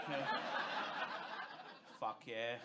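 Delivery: ordinary talk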